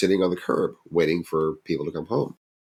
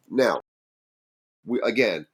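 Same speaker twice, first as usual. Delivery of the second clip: ordinary talk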